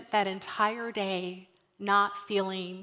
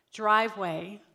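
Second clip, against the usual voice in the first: normal talking voice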